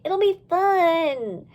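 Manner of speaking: very slow, sing-song coax; coaxing slowly. Acted. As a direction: draw it out playfully.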